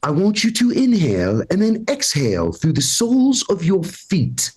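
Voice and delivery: snooty upper-crust British sleep-app voice. Retold or imitated. Sleep app guides him to bed, upper crust British. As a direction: put on a posh British guide, mock soothing. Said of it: "it's always an upper crust British voice".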